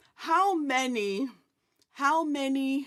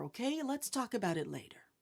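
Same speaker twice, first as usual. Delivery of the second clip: softer and quicker; soft deferring parent; 2 semitones lower, 9 dB quieter, faster than usual. Retold or imitated. Parent imitated telling child later, softer and quicker. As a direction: hush it, gently dismissing.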